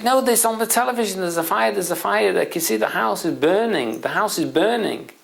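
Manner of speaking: high-pitched, frantic imitation; panicking father. Father insists to son the house is burning, acted panic. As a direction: insist in acted alarm, repeating yourself. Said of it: "the father's panicking and panicking and panicking".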